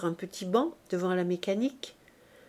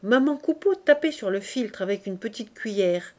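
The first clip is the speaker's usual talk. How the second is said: usual speaking voice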